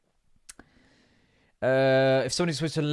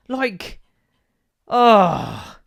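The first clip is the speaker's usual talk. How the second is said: usual speaking voice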